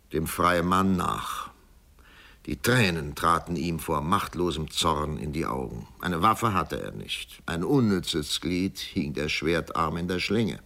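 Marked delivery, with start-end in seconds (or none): none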